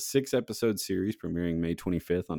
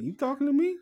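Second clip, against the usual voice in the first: Mike Tyson impression; faster than usual. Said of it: "he'd be like".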